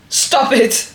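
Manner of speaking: ordinary talk, nothing marked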